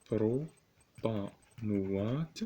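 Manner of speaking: drawn-out, very slow بروبانوات, each syllable stretched; slow dictation. Shown as a demonstration. Teacher dictating to students, stretching بروبانوات slowly. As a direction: spell it out slowly for note-taking.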